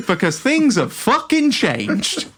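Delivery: high, slow, hammered-out mock outrage; exasperated retort. Fed-up traveller to clueless passenger, in slow mock exasperation. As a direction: spell it out to an idiot, exasperated.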